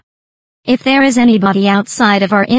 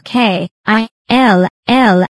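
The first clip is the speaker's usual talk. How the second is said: K I L L spelled out slowly, letter by letter; emphasized spelling; slower than usual. Shown as a demonstration. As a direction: spell K I L L slowly and deliberately, every letter stressed. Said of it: "with an emphasis on".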